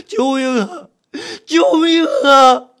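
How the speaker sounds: high, drawn-out tearful wail; terrified cry for help. Acted. Narrator frozen as the hand climbs up, terrified.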